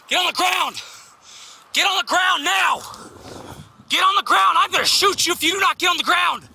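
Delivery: yelling commands at a suspect; shouted surrender demands. Real, not acted. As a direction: bark it out, full force. Officer ordering suspect at gunpoint.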